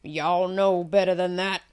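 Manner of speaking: loud, stern rebuke; scolding. A narrator's reading. A man at the fountain scolding Gertie and Connie, loud and stern. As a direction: scold them like misbehaving kids. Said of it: "the man scolded".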